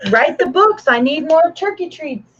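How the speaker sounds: high, bossy dog voice; voicing the dog. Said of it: "She's like".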